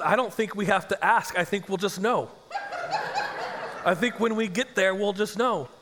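words breaking into laughter; laughing while telling. Real, not acted. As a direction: laugh through the retold answer. Retold answer, laughing through it.

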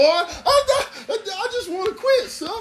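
very high, stammering meekness; pleading slave. Acted. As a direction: beg the master in a hammed-up squeak.

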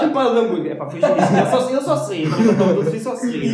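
real giggles through an excited retelling; laughing recollection. Real, not acted. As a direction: tell it while cracking up.

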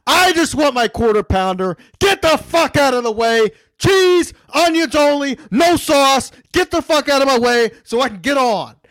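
loud, high, hammed-up rant at the guy in line; mock angry rant. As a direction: bark it out like a fed-up customer. Ranting at customer in line.

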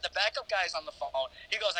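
normal talking voice

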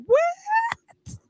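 high, startled "What?"; shocked squeal. Retold or imitated. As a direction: squeak it out in disbelief. Friend's shocked reaction retold.

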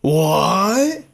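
long drawn-out, rising disbelief; incredulous. Real, not acted. Host hears the Cousins-to-Jets idea, incredulous. Reacting to a shocking trade idea.

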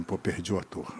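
low, very soft aside; retold dejection. Director hearing the actor's price, low resigned murmur. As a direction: let it sink, deflated and hushed.

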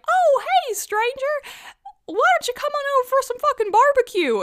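very high, loud, fast folksy invitation; mock Texan dad calling them over; dad's friendly invite. Texan dad inviting the couple to barbecue, very high and loud. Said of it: "And the dad is like".

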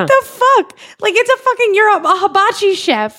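high-pitched, exasperated disbelief; real incredulity, voice jumping high; incredulous outburst. Real, not acted. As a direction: blurt it out, baffled, pitch way up. Outraged reaction to corny quoted line.

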